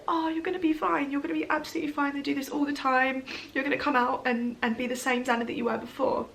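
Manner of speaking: comforting a scared friend with a smile; forced reassurance. Retold or imitated. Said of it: "I had to smile and be like".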